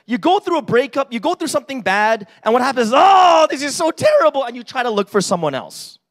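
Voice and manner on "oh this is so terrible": much higher and louder wail; mock despair. Heartbroken person wails to themselves in mock despair.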